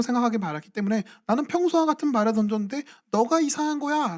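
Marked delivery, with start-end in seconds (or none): 1.3-4.2 self-righteous retort. Retold or imitated. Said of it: "라고 말하는 것이죠".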